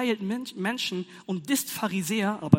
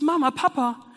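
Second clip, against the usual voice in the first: put-on child calling; 6 semitones higher, 7 dB louder than usual. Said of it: "dann ruft es".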